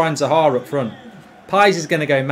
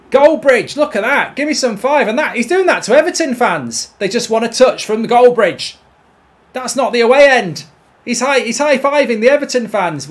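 high, raised-voice real elation; gleeful excitement; 6 semitones higher, 5 dB louder than usual. Real, not acted. Gamer watching his scorer high-five Everton fans, gleeful raised voice. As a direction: keep celebrating at a high pitch. Still celebrating the goal, watching replay.

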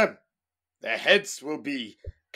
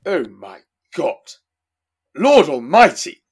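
loud cry of shock; 7 dB louder than usual. Acted. Tsar cries out in shock.

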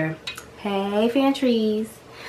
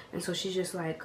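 ordinary talk, nothing marked

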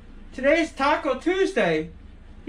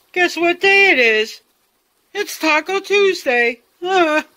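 loud, high, excited announcement; 5 semitones higher, 7 dB louder than usual. Acted. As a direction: shout it out like big news. Loud announcement of Taco Tuesday.

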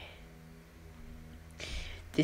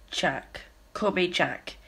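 normal talking voice

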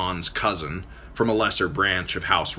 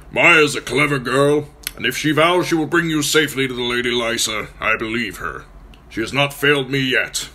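higher, louder gruff reply; gruff lord; 6 semitones higher, 6 dB louder than usual. Acted. Nestor answering Lady Stark, gruff and formal.

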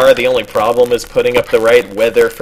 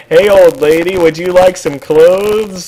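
mock charity appeal; 5 semitones higher, 4 dB louder than usual. Donor offers an old lady clothes, loud mock appeal. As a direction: call out loudly to an old lady, hammed-up.

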